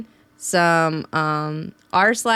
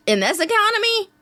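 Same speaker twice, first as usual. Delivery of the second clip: mock economy; 11 semitones higher than usual. Real, not acted. Ironic catchphrase.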